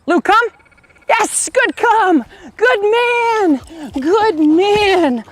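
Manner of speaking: high, bright, loud dog praise; squeaky praising a dog. Real, not acted. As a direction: praise the puppy in a squeaky happy voice. Trainer calling and praising dog.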